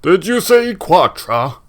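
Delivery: slow, high, loud incredulous boom. Acted. Braskin reacts to a familiar name, booming in disbelief. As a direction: boom the question out slowly. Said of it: "doing the booming voice".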